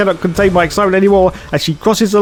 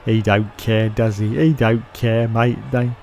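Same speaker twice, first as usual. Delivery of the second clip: low, soft aside; subdued commentary; 6 semitones lower, 7 dB quieter than usual. Pretend. Commentator watching the podium celebration, low and subdued. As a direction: let the commentary sink low and quiet.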